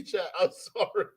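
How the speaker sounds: ordinary talk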